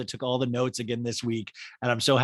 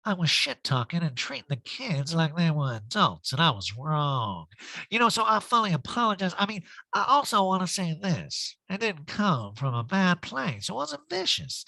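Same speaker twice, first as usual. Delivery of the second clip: lightly voiced apology; 5 semitones higher than usual. Erica apology in put-on voice.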